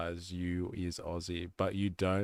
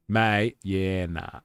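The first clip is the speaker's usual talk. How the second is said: Australian slang; 5 dB louder, much slower than usual. Pretend. Showing off the Australian accent.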